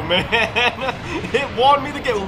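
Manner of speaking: usual speaking voice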